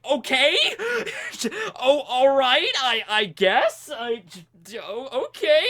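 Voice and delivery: high, halting reply through laughter; taken-aback stammer. Retold or imitated. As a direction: stammer a baffled okay while cracking up. Noelle taken aback by scream.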